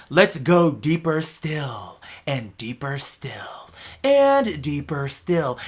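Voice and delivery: slow, hushed, mystical narrator voice sinking to a whisper; Neil deGrasse Tyson impression. Mocking Neil deGrasse Tyson's show narration, slow and hushed. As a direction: draw the listener down slowly, like a space documentary. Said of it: "eh, eh, Neil deGrasse Tyson".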